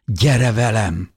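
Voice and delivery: pleading. A narrator's reading. Narrator begs beloved to come along, pleading. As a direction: draw it out imploringly. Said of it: "könyörögtem".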